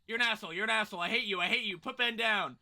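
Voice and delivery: loud whiny yelling. Real, not acted. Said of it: "you whiny bitch".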